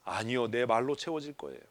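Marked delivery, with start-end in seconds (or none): none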